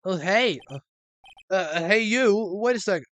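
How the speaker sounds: put-on girl calling the boy to wait. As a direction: shout after him to stop.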